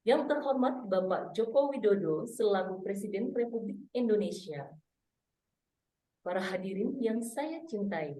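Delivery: hushed, stately MC greeting with deliberate pauses; formal MC. Shown as a demonstration. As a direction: address dignitaries as a poised formal emcee. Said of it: "pada saat jadi M C formal itu beda".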